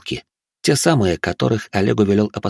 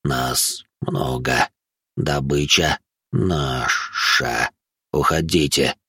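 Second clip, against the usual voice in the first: slow, deep creature voice with drawn-out sibilants; lisping ghoul; 7 semitones lower, much slower than usual. A narrator's reading. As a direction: lisp it out haltingly, low and hushed. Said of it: "после очередной заминки прошепелявил Гуль". (шепелявый гуль, низко, медленно, с паузами)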